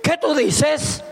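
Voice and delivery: high, slow, offended snap; hammed-up affronted husband; mock indignant retort. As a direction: imitate a husband taking offence. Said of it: "le dice".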